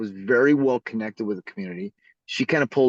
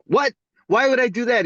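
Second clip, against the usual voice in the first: high, louder mock disbelief; incredulous judge; 10 semitones higher, 5 dB louder than usual. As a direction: balk at it like an affronted judge. Judge balks at recusal demand.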